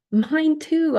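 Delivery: eager high blurt of agreement. Real, not acted. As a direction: blurt it out with delight. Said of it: "I blurt out".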